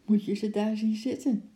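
high-pitched hushed delight; delighted whisper. A narrator's reading. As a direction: point it out, thrilled but holding it in. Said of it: "fluisterde Lotje opgetogen".

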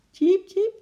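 very high, loud cheeps; squeaking squirrel. A narrator's reading. Squirrel picked up by the ape, squeaking. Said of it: "piepte hij zachtjes".

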